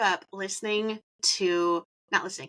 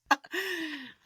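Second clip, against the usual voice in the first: high-pitched laugh; real laughing; 8 semitones higher, 5 dB quieter than usual. Real, not acted. Host cracking up with the guest as recording starts, laughing. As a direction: laugh as if it's carried on for ages. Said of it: "I'm laughing only because".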